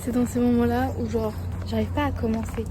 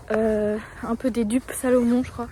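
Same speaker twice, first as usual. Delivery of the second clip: ordinary talk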